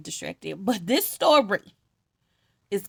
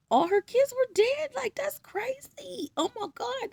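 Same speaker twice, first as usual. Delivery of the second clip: shocked disbelief. Real, not acted. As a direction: gasp at how crazy it is. Reacting to dead sons.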